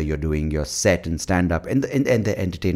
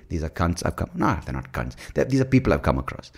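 usual speaking voice

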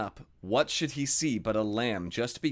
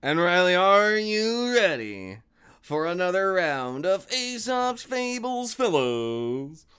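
boxer announcer voice; 3 semitones higher than usual. Acted. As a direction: call it out like a boxing MC. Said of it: "you're trying to do, like, the boxer voice".

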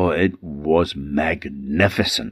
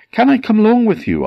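high character voice; 6 dB louder, faster than usual. Acted. Man asks to join the boat.

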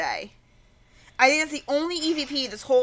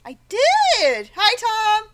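excited; 9 semitones higher, 5 dB louder, slower than usual. Real, not acted. Excited greeting to fan.

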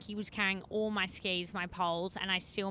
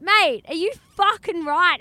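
loud, higher-pitched outraged challenge; indignant mock shout, hitting the swear; angry man retold; 8 semitones higher, 12 dB louder than usual. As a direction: yell it like an outraged stranger. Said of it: "this really angry man".

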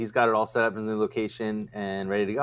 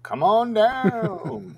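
game show host; 10 semitones higher, much slower than usual. Acted. Echoes the game show catchphrase.

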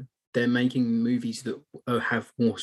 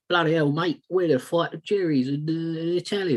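working-class bloke; 6 semitones higher than usual. Retold or imitated. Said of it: "You get more".

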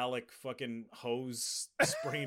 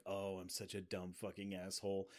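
low, much softer mumbled regret; deadpan self-blame; 6 semitones lower, 13 dB quieter than usual. Retold or imitated. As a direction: mumble it flat like a bad actor. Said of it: "this look on his face like".

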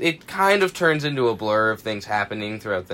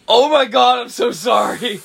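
higher, louder shocked gasp of sorry; shocked apology; 10 semitones higher, 6 dB louder than usual. Retold or imitated. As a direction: blurt out an instant apology. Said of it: "I'm just like".

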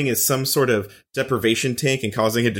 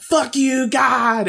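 hammed-up scream at the heavens; mock scream; 13 semitones higher, much slower than usual. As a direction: yell up at God in mock rage. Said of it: "screaming to the flower and sky".

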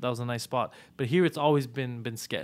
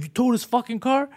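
angry demand; 9 semitones higher than usual. Retold or imitated. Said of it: "And like".

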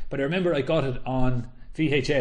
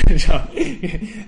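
laugh; 5 semitones higher, 11 dB louder than usual. Real, not acted. Laughs after the impression.

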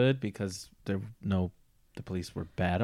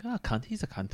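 higher, softer mock-Irish aside; finicky Irish imitation; 4 semitones higher, 6 dB quieter than usual. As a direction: toss it off lightly as an Irish person would. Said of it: "so, like, finicky".

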